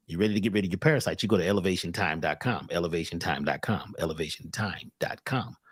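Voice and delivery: low, soft, fast plug for the website; ad read. Acted. Host plugs his site in a low, soft ad voice. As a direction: slip into a quiet ad read.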